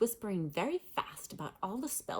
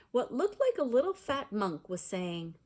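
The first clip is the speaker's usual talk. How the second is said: ordinary delivery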